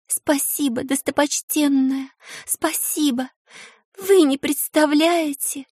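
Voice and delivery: high, slow, tearful thanks; voice breaking. A narrator's reading. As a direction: thank her through tears, voice cracking. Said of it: "срывающимся голосом поблагодарила".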